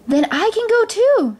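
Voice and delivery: put-on high, eager girl's voice; hopeful Cinderella. A narrator's reading. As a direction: pipe up with sudden hope. Cinderella hopes to join the ball.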